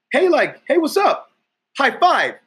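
lifted, eager mock greeting; nervous high greeting. Instructor tries again with girl, high eager greeting. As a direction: chirp it up high, overly eager.

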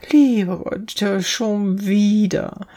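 high-pitched exclamation, drawn out at the end; crying out. A narrator's reading. Girl tumbles yet again and calls out, crying out. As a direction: wail it out in dismay. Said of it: "und rief dann".

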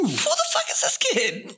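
baffled high voice. Retold or imitated.

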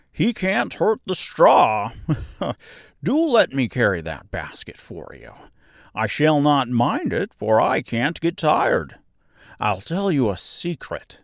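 jolly straw man offering help; good-natured scarecrow, carefree. A narrator's reading. Voices the Scarecrow.

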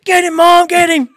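high loud egging on; kids cheering. Retold or imitated. Sons cheering mom on, loud excited chant. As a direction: urge her on excitedly.

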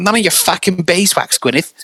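put-on high catchphrase in Big Lez Show accent. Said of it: "just doing that all the fucking time".

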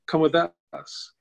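lightly imitated high-end Southern drawl, slow; high-end Southern accent. Model inviting a stranded traveler along, light high-end Southern accent. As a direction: stretch it out in a hint of refined Southern accent. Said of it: "with a Southern accent, a, a, like, high-end Southern accent".

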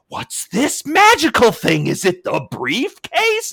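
high, slow, wide-eyed mock wonder; hammed-up high voice of awe; mock dumbfounded wrestler. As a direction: play him baffled by a simple briefcase. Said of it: "he was all like".

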